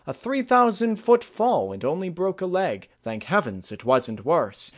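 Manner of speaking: high, loud, animated storytelling princess; princess telling an anecdote. A narrator's reading. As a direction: recount it brightly, voice swooping high. Celestia tells an anecdote.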